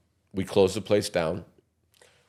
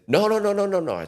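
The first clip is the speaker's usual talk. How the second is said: quick denial; 12 semitones higher than usual. Retold or imitated. Frantic denial retold.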